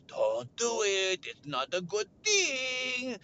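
slow, high mock wail; pleading friend. Josh begs him not to press it, slow wail. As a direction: plead slowly, rising to a whine.

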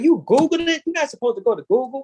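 incredulous scolding. Retold or imitated. Telling a friend off for trusting Google, high and incredulous. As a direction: call them out in high-pitched disbelief.

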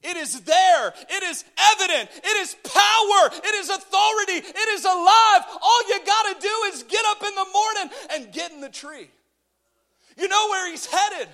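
high, shouted declarations; full-force preaching. Real, not acted. Preacher urging the congregation to get up, high punchy shout. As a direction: hammer each phrase home loudly.

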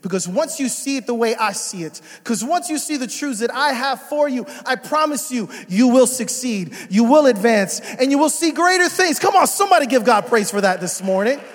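loud, high, impassioned preaching. Acted. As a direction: preach it hard and build. Preaching climax.

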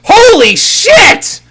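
very high, slow drawn-out shriek; mock shocked scream. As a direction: replay the shock, hammed up. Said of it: "I'll just leave my reaction in there".

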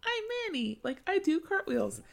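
put-on very high, little-girl chirp; squeaky mock-innocent schoolgirl; childish girl voice. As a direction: play her as a naive kid. Mocking childish Manny.

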